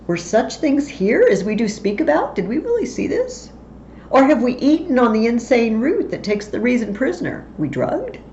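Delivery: can we trust our eyes; disbelieving Banquo. Acted.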